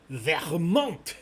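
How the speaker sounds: VERMONT with a French throaty R; French pronunciation. Shown as a demonstration. Quiz host revealing the correct answer, French pronunciation of "Vermont". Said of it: "act like you're coughing".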